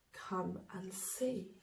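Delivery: soft, slow and tender; gentle coaxing. Acted. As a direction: coax her back with kindness. Day coaxes Night gently.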